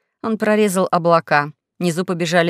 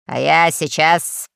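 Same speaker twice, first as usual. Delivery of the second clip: slow, threatening announcement; grim and deliberate; menacing; 2 semitones lower, slower than usual. A narrator's reading. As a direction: draw it out as a threat. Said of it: "сказал адмирал грозно".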